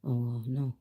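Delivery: ordinary talk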